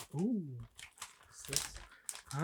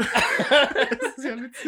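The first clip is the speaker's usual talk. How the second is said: slow, laughing and higher; 7 semitones higher, 7 dB louder, much slower than usual. Real, not acted. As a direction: speak through real laughter. Both laughing at matching gifts.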